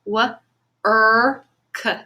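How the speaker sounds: "w-, er, k" each sound said separately; sounds stretched apart. Shown as a demonstration. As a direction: split "w-, er, k" into its three sounds. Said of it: "the R controlled vowel that I heard was er".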